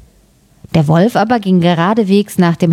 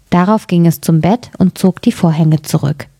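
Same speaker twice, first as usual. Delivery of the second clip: ordinary delivery, nothing marked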